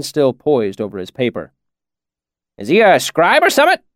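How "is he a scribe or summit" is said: put-on high curious old man; old villager voice. A narrator's reading. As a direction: ask with nosy interest. Cobb voiced.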